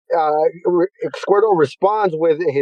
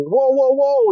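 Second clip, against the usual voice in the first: higher-pitched mock alarm; acted alarm; 7 semitones higher than usual. Ash tries to stop the three fighting, mock alarm. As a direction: break it up with raised, repeated protests. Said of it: "Ash is like".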